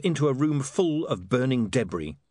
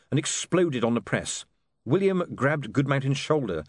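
usual speaking voice

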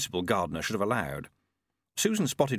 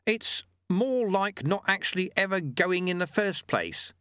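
put-on higher, patient old mentor voice; wise old monk; 8 semitones higher than usual. A narrator's reading. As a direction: teach the lesson like a wry old sweeper. Lutze voice.

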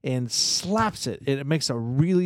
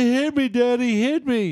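put-on high, loud whiny taunting kid voice; mocking little brother; 10 semitones higher, 3 dB louder than usual. Little brother taunting his dad after the slap, high and whiny. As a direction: egg your father on like a bratty kid. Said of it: "He said".

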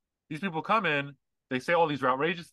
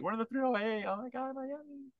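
put-on high, soft party-girl squeal; party girl impression; 12 semitones higher, 8 dB quieter than usual. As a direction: gush like a club girl in Miami. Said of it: "they're in the three oh".

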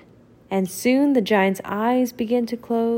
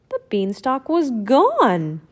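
ordinary delivery

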